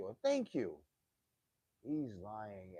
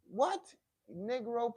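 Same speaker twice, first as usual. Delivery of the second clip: shocked What; 13 semitones higher, 7 dB louder, slower than usual. Real, not acted. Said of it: "reacting to comment".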